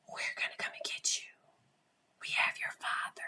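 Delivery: eerie, voiceless whispered threat; dark angels' whisper. Acted. Dark angels talking to these kids, whispered. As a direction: whisper it low to frighten a child. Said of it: "talking to these kids".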